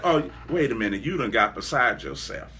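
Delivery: dismissive churchgoer, voice dropped low; mocking skeptic. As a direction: scoff at someone who got too big. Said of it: "it's like".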